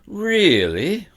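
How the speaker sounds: high, loud mock astonishment; affected surprise. A narrator's reading. Grumpy bookseller mocks the boy, high loud fake surprise. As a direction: feign surprise with a sneer. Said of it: "in affected surprise".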